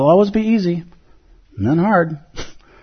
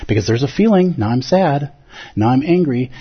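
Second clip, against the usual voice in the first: ordinary talk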